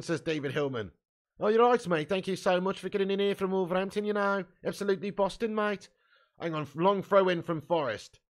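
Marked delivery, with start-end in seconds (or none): 1.4-5.9 higher-pitched, hammed-up Wolverhampton accent; put-on Wolverhampton accent. Streamer thanks a viewer from Wolverhampton, put-on Wolverhampton accent. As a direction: thank them in a mock Wolverhampton voice.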